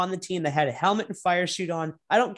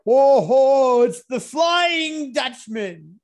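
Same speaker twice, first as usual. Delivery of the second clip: slow, loud, higher announcer-style hype; hype shout; 6 semitones higher, 6 dB louder, much slower than usual. Pretend. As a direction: bellow the nickname like a ring announcer. Hyping the championship leader.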